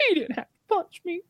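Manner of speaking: hammed-up tearful repeat; mock whimper. Guest imitating the woman repeating her complaint, high and whimpering.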